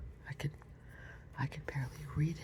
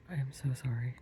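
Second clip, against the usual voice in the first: plain reading voice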